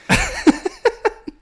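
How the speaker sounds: high-pitched burst of laughter; loud high laugh. Real, not acted. Laughing at a cheeky running joke, loud high laugh.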